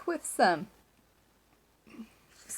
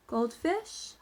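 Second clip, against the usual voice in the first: ordinary delivery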